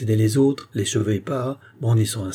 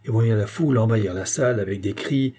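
plain reading voice

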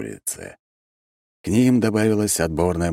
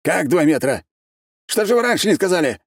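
very high, alarmed outcry; panic; 4 dB louder than usual. A narrator's reading. Архимаг learns the border moved two metres, panicking. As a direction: cry out in alarm, stretching the final words. Archmage panics at the measured shift.

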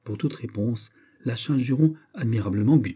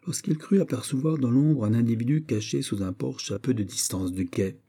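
ordinary delivery, nothing marked

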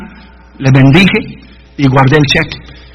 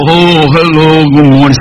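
lightly put-on Indian English accent, lower; 5 semitones lower, slower than usual. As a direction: mimic an Indian pastor answering the phone at night, low voice. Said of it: "Porque así hablan ellos".

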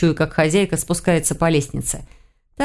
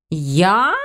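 drawn-out, rising mock wail; wailed protest. A narrator's reading. Witch accused of playing the fool, mildly wailing. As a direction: stretch it upward in mild protest. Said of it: "снова взвыла ведьма".

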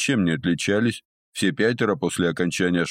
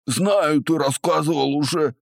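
high pained whine; 8 semitones higher than usual. Acted. Captive complaining, nose pinched.

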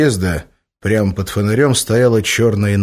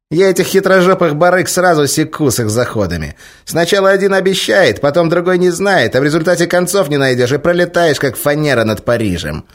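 ranting cat; 9 semitones higher, 5 dB louder than usual. A narrator's reading.

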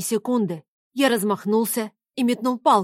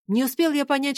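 plain reading voice

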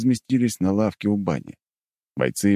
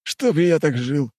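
slow, high, blissful sigh of a line; 12 semitones higher, 4 dB louder, slower than usual. Acted. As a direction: savour it, eyes closed. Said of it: "сказал он блаженным голосом".

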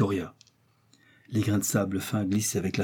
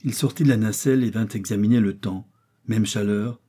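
plain reading voice

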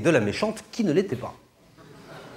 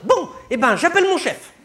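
high, louder, laughing saleswoman voice; huffy cashier; 14 semitones higher, 5 dB louder than usual. Acted. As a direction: threaten to call the boss, huffy. Cashier threatens calling boss. (caissière vexée, voix aiguë)

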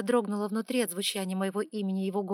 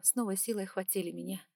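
ordinary delivery

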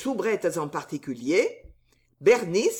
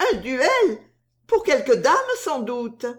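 voix aiguë et plus forte, taquine; jalouse, elle lance sa question; soubrette piquée; 6 semitones higher, 3 dB louder than usual. A narrator's reading. Susan jalouse. (Susan soupçonne une dame, voix aiguë piquée)

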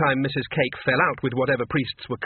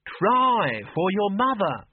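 high, slow, wheedling mum; mother pleads slowly, high-pitched; coaxing old mother; 7 semitones higher, much slower than usual. Acted. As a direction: drag out the plea to her daughter.

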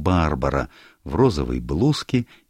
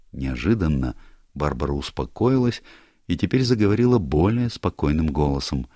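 ordinary delivery

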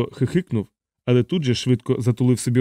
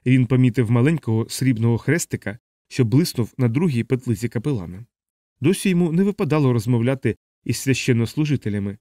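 ordinary delivery, nothing marked

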